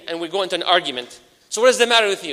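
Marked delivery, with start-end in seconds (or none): 1.5-2.3 louder, higher mock confrontation; acted anger. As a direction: pick a staged fight with the brother. Acted argument with volunteer.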